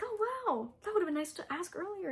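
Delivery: high, fast mock astonishment; mock surprise. Bride mocking groom's teasing question.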